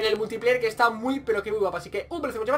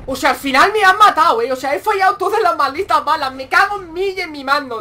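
loud, raised-pitch frustrated rant; angry ranting; 4 semitones higher, 7 dB louder than usual. Real, not acted. Gamer killed after missing every bullet, ranting angrily. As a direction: curse your own bad aim loudly.

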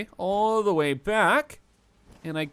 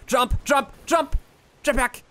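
high, loud frantic shouts; panicked commands; 8 semitones higher, 4 dB louder than usual. Real, not acted. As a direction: yell it in a real panic. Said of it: "Sorry, panicking".

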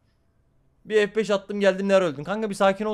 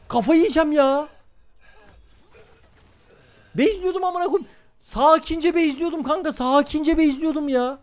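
high, pleading whine; whiny complaint; 11 semitones higher, 4 dB louder than usual. Real, not acted. Streamer complaining to chat after dying, high whine. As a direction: whine that it is not fair.

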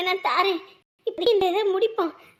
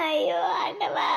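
slow, broken crying wail; sobbing plea to God; 3 semitones lower, 7 dB quieter, slower than usual. Acted. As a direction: let the cry break through the words. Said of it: "அழாத, அழாத".

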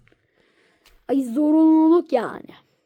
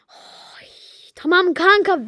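ordinary talk

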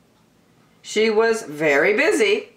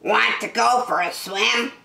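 quacky duck character voice, pitched up; higher-pitched talking duck; calling duck; 5 semitones higher than usual. Acted. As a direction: invite them as a storybook duck. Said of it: "called the duck".

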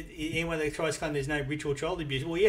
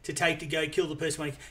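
ordinary talk, nothing marked

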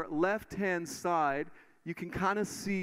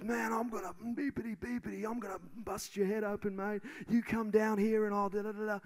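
mock angry bikie, pitched higher but softer; heated threat; 6 semitones higher, 4 dB quieter than usual. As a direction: imitate a drunk, cranky bloke threatening. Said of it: "starts to get really heated".

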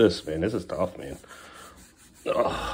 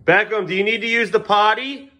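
raised parental call; 9 semitones higher, 5 dB louder than usual. Real, not acted. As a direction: call across the house, rising at the end. Calling to child in another room.

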